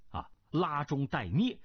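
plain reading voice

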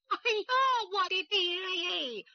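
high, drawn-out cry of grief; 悲痛哭喊; anguished wail. A narrator's reading. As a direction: wail for the dead father. Daughter hears father died.

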